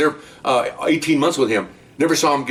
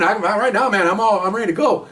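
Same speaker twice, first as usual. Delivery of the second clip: much higher breezy mock agreement; eager suspect; 10 semitones higher than usual. Suspect cheerfully agreeing to find women, higher breezy. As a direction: toss it off like a happy hustler.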